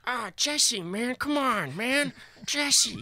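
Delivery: high, far-off whiny stoner voice; Badger impression. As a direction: whine like a burnt-out druggie. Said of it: "That's my Badger impression".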